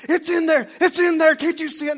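high, loud, frantic insisting; insistent wife with something in her eye, high and loud. Retold or imitated. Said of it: "She's like".

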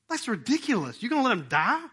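usual speaking voice

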